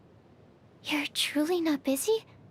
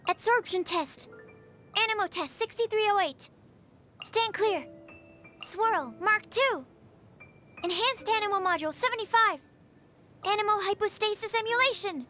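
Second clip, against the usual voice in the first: higher-pitched, energetic skill calls; combat callout; 5 semitones higher than usual. Acted. Researcher calls out experimental attacks, combat callout. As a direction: announce each attack with urgency.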